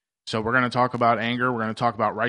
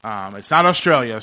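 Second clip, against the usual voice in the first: ordinary delivery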